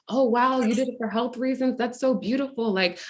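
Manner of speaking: mildly gushing retold praise; admiring stranger. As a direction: imitate a stranger who is impressed. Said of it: "And she was like".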